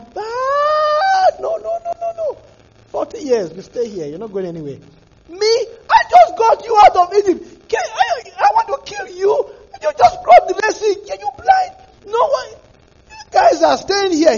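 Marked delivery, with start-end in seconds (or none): 0.0-2.4 high, slow crying shout of an exasperated God; wailing God voice. Retold or imitated. God voiced scolding Israelites. 5.3-14.4 very high, loud outraged shouting; furious God. Retold or imitated. God voiced in wrath.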